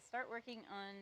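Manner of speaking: normal talking voice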